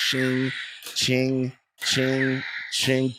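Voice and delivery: imitated lightsaber. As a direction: make lightsaber swish noises, drawn high. Imitating lightsaber swing noises.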